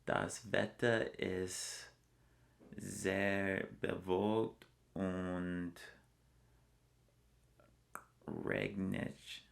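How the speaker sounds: slow, deliberate German words; careful German. Shown as a demonstration. Traveler put on the spot speaks German slowly. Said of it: "Can you say anything?".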